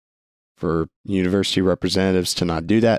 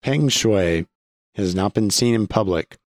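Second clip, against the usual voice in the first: normal talking voice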